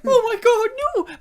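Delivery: high, loud panicked cry; mock terror. Actor terrified of the ocean, mock terror. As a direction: wail it in hammed-up fear. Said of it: "he'd probably be shit scared".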